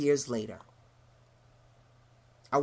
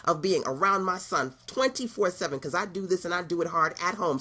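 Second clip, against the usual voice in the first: ordinary talk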